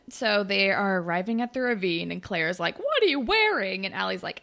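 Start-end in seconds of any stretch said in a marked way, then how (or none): 2.8-3.8 high, judgy disbelief; incredulous. Retold or imitated. Claire eyeing her friend's outfit, incredulous. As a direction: pitch the question up in disbelief.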